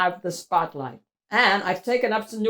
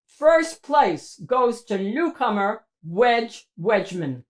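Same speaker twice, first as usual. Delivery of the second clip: ordinary delivery, nothing marked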